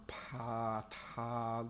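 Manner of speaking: pa and ta with aspirated onsets. Shown as a demonstration. Streamer sampling sounds for an incantation, aspirated p and t. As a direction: puff of air after p and t. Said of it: "I think an aspirate is".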